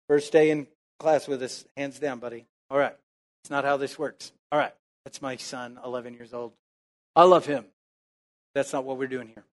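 quiet aside. Real, not acted. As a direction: drop the voice to a private word. Aside correcting his son.

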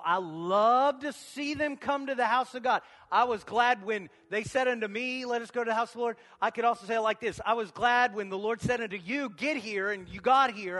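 preacher warming up; rising preacher fervour. Acted. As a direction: build the fire in the sermon. Preaching lift.

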